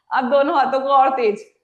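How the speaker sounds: ordinary delivery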